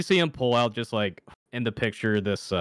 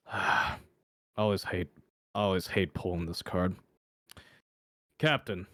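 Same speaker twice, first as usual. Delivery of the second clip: weary reluctance; 3 semitones lower, 4 dB quieter than usual. Acted.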